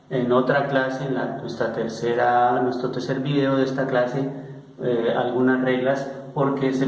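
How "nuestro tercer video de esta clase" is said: ordinary delivery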